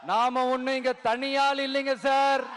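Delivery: ringing political speech with long-held line endings; rally oratory. Real, not acted. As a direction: rouse a huge crowd, pausing for cheers. (மேடைப் பேச்சு, முழக்கமாக)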